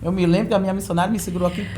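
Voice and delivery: ordinary talk, nothing marked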